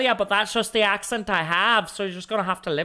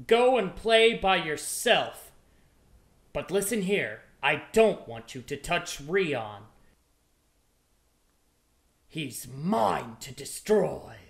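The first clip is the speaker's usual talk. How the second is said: menacing villain; 5 semitones lower than usual. Acted. Impressions demo, villain warning.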